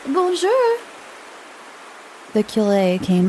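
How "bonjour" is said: high bright call of greeting; high called-out bonjour. A narrator's reading. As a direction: call a cheery hello across the churchyard. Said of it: "Dora was already calling out".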